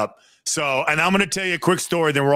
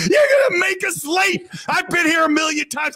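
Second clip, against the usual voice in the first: retold angry yell; 12 semitones higher than usual. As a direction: yell it like the impatient player. Retold quarrel with bus driver.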